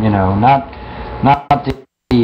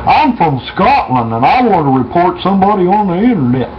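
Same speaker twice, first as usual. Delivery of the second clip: a rough attempt at a Scottish accent, pitched up; half-hearted Scottish troll voice; mock Scottish accent; 8 semitones higher than usual. Troll phoning from Scotland, mock Scottish accent. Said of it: "I don't know if I can do a Scottish accent".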